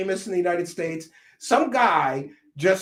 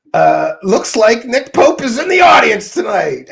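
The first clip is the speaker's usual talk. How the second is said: loud, jeering announcement; big, loud taunt; mocking call-out; 3 semitones higher, 9 dB louder than usual. Real, not acted. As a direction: call out the heckler for all to hear. Loudly calling out a heckler.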